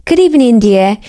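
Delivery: put-on crisp British accent. Femi rehearsing before the mirror, crisp British accent. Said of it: "he said in crisp British English".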